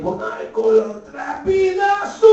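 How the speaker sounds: slow, climbing high wail; mock cry of pain. Comic joking with Greek buddies, rising mock wail.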